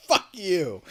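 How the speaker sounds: bellowed insult, imitating a movie hero; loud shout. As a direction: shout it straight at your enemy. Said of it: "he does this loud shout".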